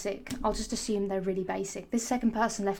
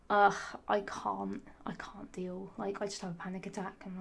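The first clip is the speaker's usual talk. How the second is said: panicked whisper; 6 dB quieter than usual. Retold or imitated. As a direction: breathe it out as if panicking. Panic about talking on phone.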